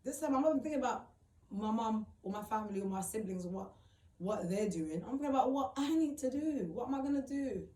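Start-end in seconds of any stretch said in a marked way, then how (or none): 5.1-7.7 raised, higher-pitched worried self-questioning; distressed outburst. Retold or imitated. Homeless parent asking themselves what to do, distressed outburst. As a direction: voice lifted in mounting worry. Reliving panic over having nowhere.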